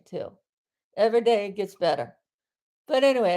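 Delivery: normal talking voice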